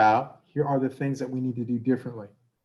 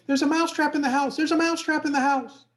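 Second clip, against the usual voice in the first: high, frantic little mouse cry; panicked mouse yell; 14 semitones higher, 3 dB louder than usual. Acted. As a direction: shout it like a terrified tiny mouse. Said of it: "he says".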